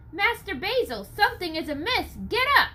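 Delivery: high urgent plea; urgent wake-up. Acted. Nathaniel urges sleepy Basil to get up, high and urgent. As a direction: rouse the old man, urgently. Waking his master in alarm.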